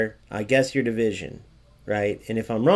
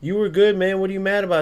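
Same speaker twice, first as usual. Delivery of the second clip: much higher, whiny mock outrage; mocking annoyed player; 7 semitones higher, 3 dB louder than usual. Streamer mimicking an upset opponent, whiny mock outrage. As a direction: imitate a touchy player getting defensive. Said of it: "if they get mad, like".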